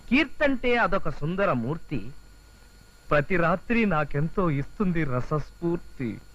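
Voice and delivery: slow, low poetic reading; poem recital. Acted. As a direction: recite your own love verse with drawn-out words. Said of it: "ఇంకేం రాశానో చదువుతాను చూడు".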